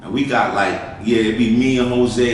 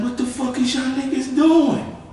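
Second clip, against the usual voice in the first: shocked question; 12 semitones higher than usual. Retold or imitated. Confronting friends grinding cocaine.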